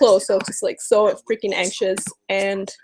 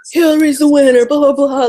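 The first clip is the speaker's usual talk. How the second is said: mocking media; 4 semitones higher, 7 dB louder than usual. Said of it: "they're gonna be like".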